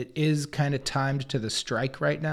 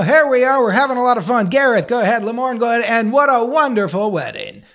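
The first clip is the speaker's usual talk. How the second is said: loud, high-energy emcee patter; big upbeat host voice, projected to the room; host mode; 6 semitones higher, 8 dB louder than usual. Acted. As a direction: play the showy event host. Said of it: "I was gonna be in host mode".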